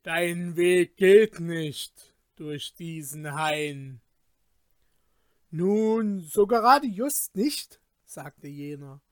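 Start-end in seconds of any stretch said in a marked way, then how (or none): 0.0-4.0 slow, loud looming warning; menacing giant voice. A narrator's reading. Forest giant warns Peter. 5.5-7.8 loud, higher, flustered excuse; nervous evasion. A narrator's reading.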